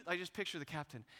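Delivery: ordinary talk, nothing marked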